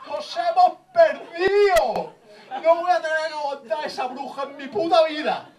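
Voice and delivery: high, defiant hammed-up cry; mock lost husbands. Lost husband refuses to go home to his wife, high mock wail. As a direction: wail it out in mock desperation, stretching words. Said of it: "me imagino los tres tíos".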